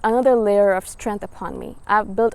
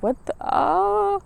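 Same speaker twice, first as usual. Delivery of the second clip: mock baffled; 9 semitones higher, much slower than usual. Baffled reaction to the question.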